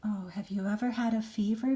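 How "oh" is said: plain reading voice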